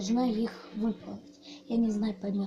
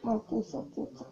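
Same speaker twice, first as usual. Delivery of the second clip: mouth full; 2 semitones higher, 5 dB louder than usual. Real, not acted. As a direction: praise and commands through a mouthful. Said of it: "Сложно говорить".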